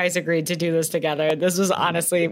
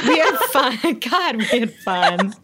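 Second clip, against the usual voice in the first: laughing; 4 semitones higher than usual. Real, not acted. Laughing through the line.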